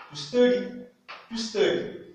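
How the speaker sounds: to study dit haut et fort; to study en modèle, voix haute; English model word. Shown as a demonstration. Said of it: "U devient E". (to study avec E, voix haute)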